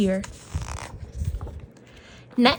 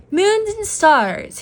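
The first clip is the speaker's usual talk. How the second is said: grand naming; 9 semitones higher, much slower than usual. A narrator's reading. Magician names Mouse grandly.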